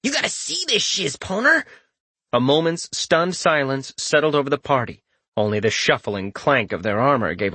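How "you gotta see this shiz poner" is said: high excited call. Acted. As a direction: call your buddy over, amazed. Dark elf calls out.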